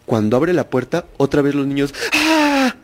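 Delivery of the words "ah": sharp high mock scream; loud high imitated scream; retold children's scream. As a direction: shriek like terrified kids.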